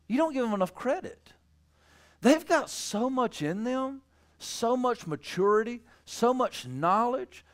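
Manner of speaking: high, pleading preacher's cadence; earnest preaching appeal. Real, not acted. Preacher urging parents to credit their child, earnest high-pitched appeal.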